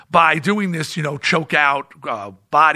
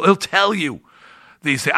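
ordinary talk, nothing marked

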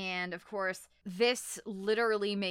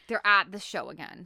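normal talking voice

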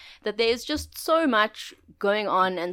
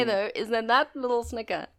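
usual speaking voice

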